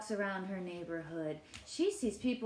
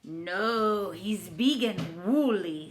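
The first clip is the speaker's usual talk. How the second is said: character voice; 6 dB louder, slower than usual. Acted. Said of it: "says Mister Eduardo".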